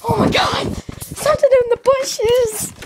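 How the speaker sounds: high, loud frightened exclamation; startled shout. Real, not acted. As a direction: yell out in real shock. Startled by noise in the bushes.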